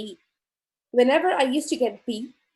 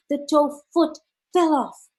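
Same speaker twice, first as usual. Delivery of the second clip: ordinary talk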